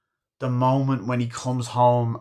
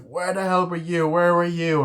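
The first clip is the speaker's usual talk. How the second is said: high, raised-voice demand; mock angry wife; 8 semitones higher, 5 dB louder than usual. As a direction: act out a wife telling off her husband. Wife confronting husband coming home.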